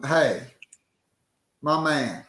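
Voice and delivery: normal talking voice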